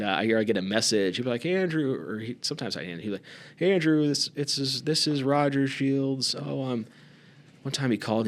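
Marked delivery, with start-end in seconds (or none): 1.4-1.9 higher, mock old-man phone greeting; a hint of Roger on the answering machine; lightly voiced old man calling on the phone; imitating Roger's greeting. Roger's phone message retold. 3.6-6.9 put-on higher, slow, halting old-man phone voice; doddering old man leaving a message; slow mock voicemail from an elderly friend; imitating Roger's voicemail.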